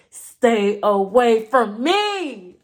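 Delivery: loud, very slow, each word stretched; emphatic, drawn-out keep-your-distance; defiant warning. Real, not acted. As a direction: warn them off, drawing out every word. Defiant warning to her detractors.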